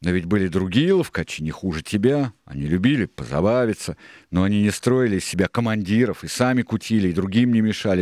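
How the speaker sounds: loud protest, climbing in pitch; Israel, argumentative. A narrator's reading. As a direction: argue back at the ringleader. Israel argues with Silver.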